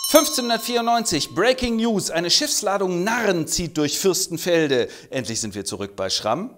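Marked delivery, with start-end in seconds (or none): 0.1-4.9 higher, punchy newsreader announcement; hammed-up news anchor; breaking news flash. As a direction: sell it like a breaking headline.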